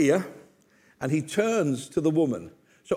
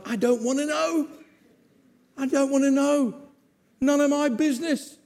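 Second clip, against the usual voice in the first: emphatic refusal; 8 semitones higher than usual. Real, not acted. Preacher refusing to hear confessions.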